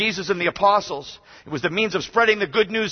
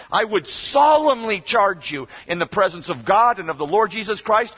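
ordinary delivery

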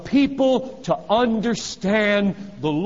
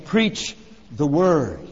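each word drawn out slowly, landing hard; slow down and plant every word; preacher lands the command slowly and firmly; slow emphatic command; 4 semitones lower, much slower than usual. Acted. Climax of list of places.

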